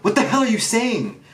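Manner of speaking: high, frantic shouted plea; alarmed Luffy. Retold or imitated. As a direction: yell after her in panic. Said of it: "And Luffy's like".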